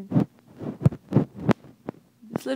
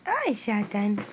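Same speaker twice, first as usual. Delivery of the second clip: usual speaking voice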